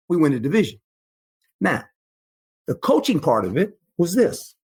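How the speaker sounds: ordinary talk, nothing marked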